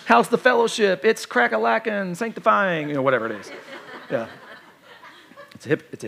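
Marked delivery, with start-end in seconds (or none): 0.0-2.9 higher, sing-song pious small talk; put-on church greeter. Pastor showing his church mask to a brother, put-on pious cheer. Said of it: "I got my church mask".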